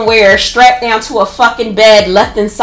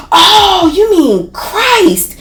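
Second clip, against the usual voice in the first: higher, slow, drawn-out dramatic Oh, you mean Christ; roommate's alarm; 9 semitones higher, much slower than usual. Retold or imitated. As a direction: roommate points and gasps the word out slowly. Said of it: "And she was like".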